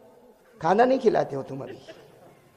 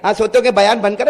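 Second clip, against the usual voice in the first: ordinary talk, nothing marked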